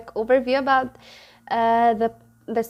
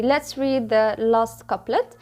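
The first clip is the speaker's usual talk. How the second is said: normal talking voice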